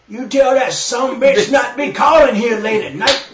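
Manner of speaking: put-on loud, slow, angry old-man yell; gruff old man shouting. Angry father yelling about a late call. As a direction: bellow it like a cranky dad at night. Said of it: "this is how Gilbert referred to the girl".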